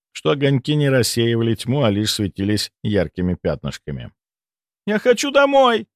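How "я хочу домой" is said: high plaintive whimper; whiny plea. Acted. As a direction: plead like a scared girl. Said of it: "жалобный голос".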